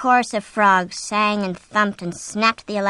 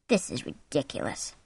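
low, hushed annoyance; soft anger; 8 semitones lower, 8 dB quieter than usual. A narrator's reading. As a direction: say it softly and angrily. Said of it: "he said softly and angrily".

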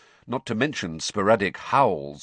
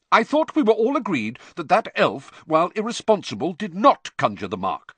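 heated; 10 semitones higher, 5 dB louder than usual. A narrator's reading. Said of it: "said Percy hotly".

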